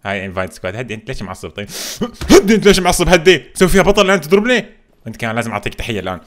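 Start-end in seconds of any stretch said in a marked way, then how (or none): 2.0-4.7 loud, higher-pitched protest; alarmed shout. Real, not acted. Player yelling at an attacker to calm down, alarmed shout. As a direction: shout at them to back off.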